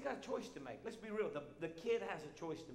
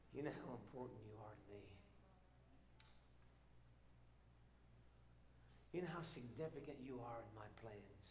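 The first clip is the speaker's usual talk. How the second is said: much softer, lower, tender words of love; God's tender voice; 4 semitones lower, 10 dB quieter than usual. Acted. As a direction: speak as God reassuring his child, hushed. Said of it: "sees you and says".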